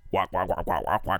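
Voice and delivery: low quack from a huge duck; giant duck voice. Retold or imitated. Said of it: "three octaves lower".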